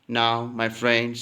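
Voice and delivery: normal talking voice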